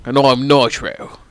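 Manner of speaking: mock English-Australian accent; wobbly put-on English accent. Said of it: "I'm gonna be Nitro".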